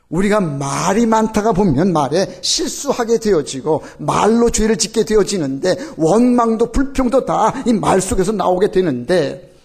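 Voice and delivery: full-force sermon delivery; fervent preaching. Acted.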